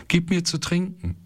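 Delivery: a high, tearful child's voice, lightly acted; crying child. Ismael begging his mother for water, a lightly acted high whimper. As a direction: beg weakly for a drink. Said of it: "weinte Ismael".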